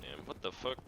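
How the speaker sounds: ordinary talk, nothing marked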